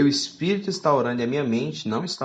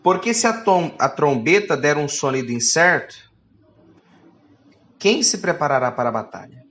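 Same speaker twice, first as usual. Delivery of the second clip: louder, declaiming Bible reading; scripture reading; 6 dB louder than usual. Acted. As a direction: proclaim the verse to the church. Reads scripture aloud.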